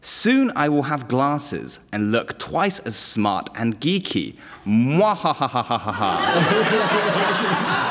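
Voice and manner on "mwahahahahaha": hammed-up evil cackle; slow theatrical villain laugh; mad scientist. Evil laugh after "look smart.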